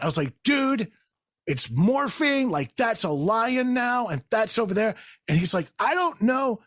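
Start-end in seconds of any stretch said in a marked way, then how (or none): 0.4-4.9 slow, high-pitched awe; stoned wonder, voice lifted; tripping amazement. Retold or imitated. Acted awe at imagined light show. 5.8-6.6 high, unsure retort; doubtful friend. Retold or imitated.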